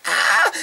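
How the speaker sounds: high, loud fan squeal; excited squeal. Retold or imitated. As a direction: let out a starstruck squeal. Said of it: "I was like".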